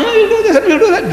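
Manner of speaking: fast, very high-pitched prattle; mock big talker. Imitating the guy who only talks, mock boasting. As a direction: rattle off empty boasts up high. This guy's talking.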